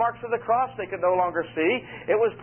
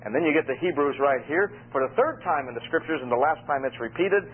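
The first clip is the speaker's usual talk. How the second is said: ordinary talk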